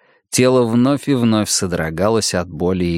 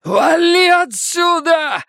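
desperate high-pitched drawn-out yell; full-voiced scream to drive someone away; slower than usual. A narrator's reading. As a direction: scream it high, stretching out the words. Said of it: "заорал Бадди".

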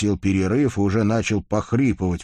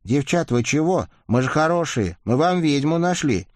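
higher, anxious coaxing; nervous pleading; 8 semitones higher than usual. A narrator's reading. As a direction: coax the girls nervously. Pleading with rusalkas.

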